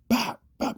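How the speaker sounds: high sharp bap bap like rifle shots; imitated gunshot bap. Retelling the interruption, a high mock gunshot sound. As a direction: mimic two quick shots.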